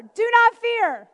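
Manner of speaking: slow, loud do not fear; firm command. Acted. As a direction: deliver it like a prophet's order. Elijah's command, slowed.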